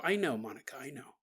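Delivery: low, very soft reassurance; quiet, trailing comfort; soothing father. Acted. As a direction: hush a worried child gently.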